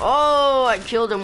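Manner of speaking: sudden high loud whoop; excited shout. Real, not acted. Gamer whooping after killing an opponent. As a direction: yelp with the kill.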